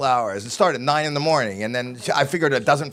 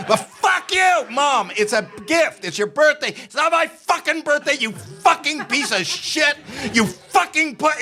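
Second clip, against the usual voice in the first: demented mom's furious yell; 12 semitones higher than usual. Retold or imitated. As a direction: bark it at your son like an angry old mom. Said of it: "And I go".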